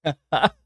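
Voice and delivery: high burst of laughter. Real, not acted. Host reacts to Jason's chat joke, laughing. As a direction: genuine amused laugh.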